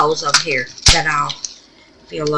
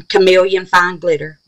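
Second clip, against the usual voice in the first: ordinary delivery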